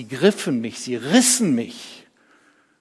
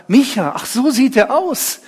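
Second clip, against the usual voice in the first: high, loud wonder; staunend; 11 semitones higher, 8 dB louder than usual. Acted. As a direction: exclaim at seeing a friend's face. Sieht den Freund erstmals. (freudig staunend)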